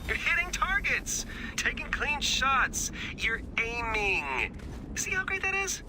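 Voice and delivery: high-pitched, drawn-out encouragement; delighted cheering. Acted. Coach cheering on target practice.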